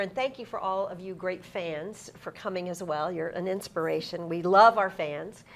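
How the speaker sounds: usual speaking voice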